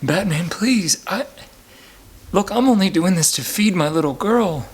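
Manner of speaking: hammed-up plea to Batman; mock pleading. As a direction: beg for mercy on your knees. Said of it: "I fall to my knees and say".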